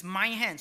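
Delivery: MY punched loud in "my hand"; emphatic. Shown as a demonstration. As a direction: hit "my" hard in "my hand". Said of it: "so it's emphatic".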